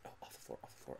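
hushed whispered repeat; whispering. Real, not acted. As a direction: breathe it under your breath. Muttered repeat under breath.

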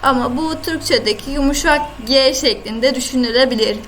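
ordinary talk

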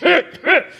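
high pitched mock coughing fit; imitated cough. The coughing accountant in the seminar, a mock high cough. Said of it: "he keeps".

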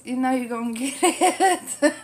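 loud teasing through laughter; real laugh bubbling up at the end; laughing threat. Real, not acted. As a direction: break into a laugh on the playful warning. Teasing the friends, breaks into laughing.

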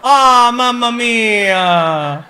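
drawn-out, loud Italian-style mamma mia; hammed-up Italian exclamation, slow and loud; mock Italian. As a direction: stretch it like a cartoon Italian.

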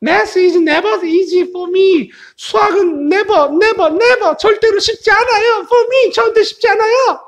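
very high, whiny cartoonish complaint; high-voiced protesting character. Speaker A saying math is never easy, high protesting voice. 근데 뭐라 그래요?.

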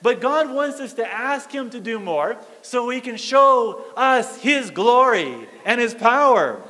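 ordinary talk

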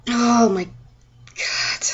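long, drawn-out exasperated groan of words; exasperated sigh. Real, not acted. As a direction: let it out very slowly, fed up. Venting, close to tears.

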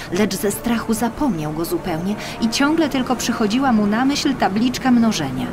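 ordinary delivery, nothing marked

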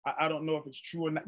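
low, soft, hesitant mumble; doubtful waffling. Acted. Preacher voicing a doubting believer, doubtful waffling. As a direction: imitate an unsure believer, quick and under the breath. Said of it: "you can kinda like".

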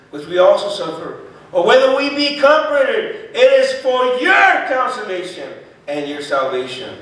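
impassioned scripture reading. Acted. Preacher telling believers it is for their consolation, impassioned scripture reading. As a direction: drive the verse home with force.

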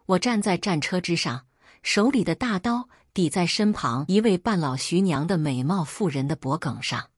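plain reading voice

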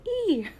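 short high "eee" of glee; excited squeal. Real, not acted. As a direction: let out a giddy little squeal. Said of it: "I'm so, so excited".

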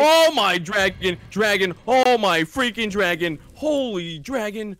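loud, slow, drawn-out exclamations; panicking. Real, not acted. Gamer seeing a dragon fly at him, loud panicking. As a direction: cry out in comic alarm. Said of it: "sounds like you're panicking for no reason".

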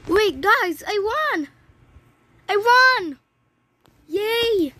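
high, loud cheer of winning; real thrilled yell; excited victory shout. Real, not acted. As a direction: shout the win to the viewers. Wins the game.

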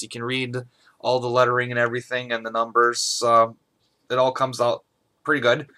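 ordinary delivery

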